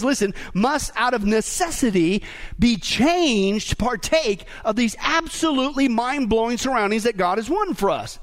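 normal talking voice

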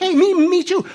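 high, loud, excited greeting; hammed-up delight. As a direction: exclaim it with comic over-eagerness. Joke character finds common ground.

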